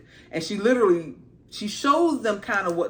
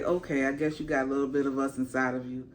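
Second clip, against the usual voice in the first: lower, softer, fast easygoing voice; accepting the rider casually; laid-back dragon voice; chill dragon; 8 semitones lower, 5 dB quieter, faster than usual. Retold or imitated. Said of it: "the dragon just like".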